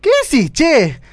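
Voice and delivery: high, loud, affronted retort; sharp indignant protest; offended. Acted. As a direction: snap back at the insult. Offended by friend's sex remark.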